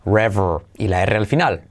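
ordinary delivery

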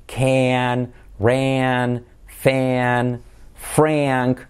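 CAN, RAN, FAN, FRANK with a long "eh-a"; one stretched word at a time; drilled vowel. Shown as a demonstration. Teacher listing spelling examples, drawn-out vowel. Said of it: "con el sonido vocálico ea".

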